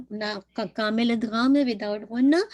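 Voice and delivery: ordinary talk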